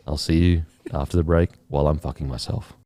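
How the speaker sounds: low, relaxed radio presenter. Pretend. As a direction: play a low-voiced AM host. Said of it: "It's fully A M radio".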